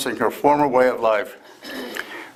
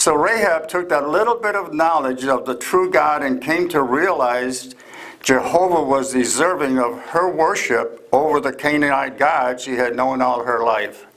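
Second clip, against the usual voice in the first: ordinary talk, nothing marked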